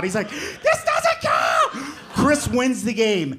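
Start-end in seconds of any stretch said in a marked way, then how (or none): 0.3-1.7 put-on high, drawn-out kid wail; mock protest. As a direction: stretch it into a squealed complaint. Mark protesting his loss.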